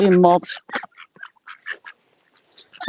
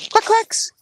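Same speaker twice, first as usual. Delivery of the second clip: calling the ducks; 13 semitones higher, 5 dB louder than usual. Real, not acted. Calling ducks near the swans.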